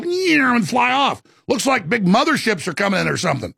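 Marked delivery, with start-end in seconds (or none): 0.0-0.5 a much higher "Yeah" like things zooming off; imitated orb sound. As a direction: squeal "Yeah" as the little orbs shoot away. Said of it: "all these little things go".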